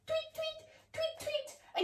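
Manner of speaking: tiny high soft tweets; bird tweets. Shown as a demonstration. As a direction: chirp softly like a little bird. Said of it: "our Tweety bird pose".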